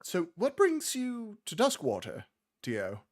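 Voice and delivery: high, chatty gnome voice; curious gnomish woman. Acted. Gnome woman on the cart asking Dio why he travels, high and curious. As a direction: pitch it up into the little gnome lady. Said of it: "the gnomish woman looks at you and goes".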